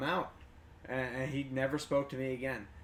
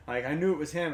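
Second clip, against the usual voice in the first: normal talking voice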